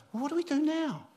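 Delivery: high, baffled question; a new dad suddenly lost; bewildered new dad. Retold or imitated. New father asking his wife, high and baffled. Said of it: "and I remember saying".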